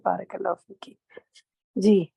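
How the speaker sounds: normal talking voice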